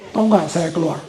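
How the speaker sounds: usual speaking voice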